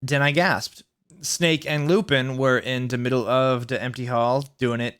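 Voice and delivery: usual speaking voice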